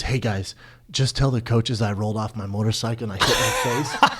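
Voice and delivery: low, soft, subdued voice; mock sheepish request. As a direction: drop it low like an embarrassed friend. Friend sheepishly asking for cover story.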